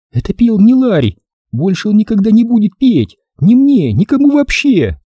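high-pitched frantic female voice; distraught woman. Acted. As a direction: declare it in anguished high register. Тони explains in anguish.

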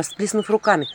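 usual speaking voice

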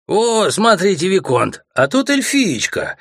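high, loud mocking noble; drunken leer. A narrator's reading. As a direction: jeer at the elf girl. Drunk noble jeers loudly.

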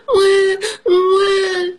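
high wailing wah wah; a storyteller's pretend baby sob; mock baby crying. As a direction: cry like a little baby mouse. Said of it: "he cries".

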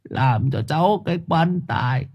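high plea breaking into a drawn-out wail; weeping, stretched-out cry of grief; tearful wail. A narrator's reading. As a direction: announce the death while bursting into tears. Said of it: "Thưởng Báo lại trào nước mắt".

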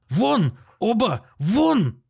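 high, punched one-word commands; frenzied shouting. A narrator's reading. Doctor throws both visitors out, beside himself. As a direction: order them out in a fit. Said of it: "крикнул он не своим голосом".

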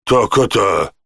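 ordinary delivery, nothing marked

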